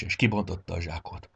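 usual speaking voice

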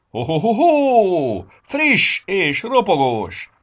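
loud, high, drawn-out ho-ho-ho; big, slow, festive holler; jolly Santa. A narrator's reading. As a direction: bellow merrily like Santa handing out treats. Santa reveals the sack of greens.

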